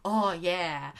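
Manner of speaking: low, soft, content sinking into cold water; a lazy pleased murmur; relieved sigh. Retold or imitated. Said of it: "He's like".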